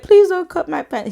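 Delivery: high, whiny mock-crying plea; retold crying, high and pleading; tearful begging. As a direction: beg tearfully, pitched high. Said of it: "And I started crying more".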